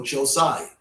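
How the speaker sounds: Josiah as jo-SYE-uh; common pronunciation. Shown as a demonstration. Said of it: "commonly called".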